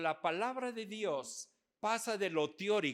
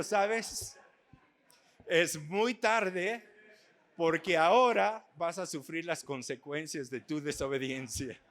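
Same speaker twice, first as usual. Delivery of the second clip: louder, drawn-out mock threat; mock stern warning; 9 dB louder than usual. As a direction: play the strict grandfather, slowly and loudly. Preacher retells scolding the child.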